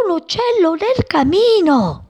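put-on high excited child voice; child shouting. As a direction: spot the bird and tell everyone. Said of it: "gridò un bambino".

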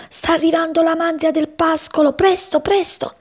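high-pitched, frantic warning; very agitated. A narrator's reading. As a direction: urge them to hurry, highly agitated. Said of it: "era Ermete agitatissimo".

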